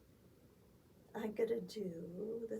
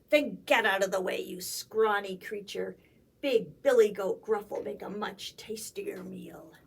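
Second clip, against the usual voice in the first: loud troll; 3 semitones lower, 7 dB louder than usual. A narrator's reading. Troll threatens scrawny goat.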